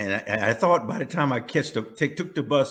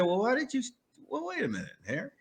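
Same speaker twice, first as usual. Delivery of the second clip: mother; 10 semitones higher, 7 dB quieter than usual. Retold or imitated. Mother catches on.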